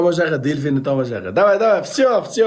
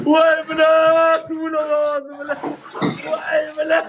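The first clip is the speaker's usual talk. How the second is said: pained yelling; 8 semitones higher, much slower than usual. Real, not acted. Still hurting after the dolphin.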